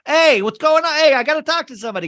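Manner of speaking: high, loud, fast cop voice; pushy cop. Retold or imitated. Policeman calling into the busy house, high and loud. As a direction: bark it like a nosy cop at the door. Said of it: "who keeps going".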